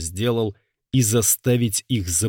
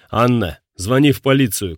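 loud, urgent higher shout; 3 semitones higher, 7 dB louder than usual. Acted. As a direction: yell the order in alarm. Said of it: "крикнул Марк".

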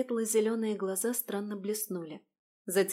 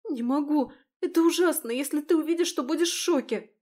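panicked; 7 semitones higher, 6 dB louder than usual. Acted. Паника за дверью.

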